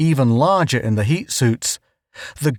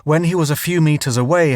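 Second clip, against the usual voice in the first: ordinary delivery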